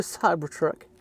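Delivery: Cybertruck in a put-on American accent; playful American English pronunciation. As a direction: say it slowly with a showy American accent. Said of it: "mon accent américain, excusez-moi, c'est naturel".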